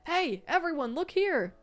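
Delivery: high, loud call over a crowd; hollering. Acted. Wei Wuxian hollering at brawling youths from the stairs. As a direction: yell to grab everyone's attention. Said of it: "hollering at the crowd".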